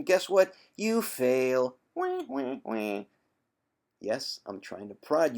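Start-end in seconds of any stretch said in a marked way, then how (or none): 1.9-3.0 mock crying wah. Mocking crybaby failure sound.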